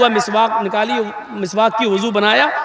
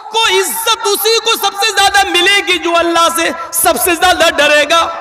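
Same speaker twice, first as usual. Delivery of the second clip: preaching climax; 7 semitones higher than usual. Real, not acted. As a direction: build to a fervent peak.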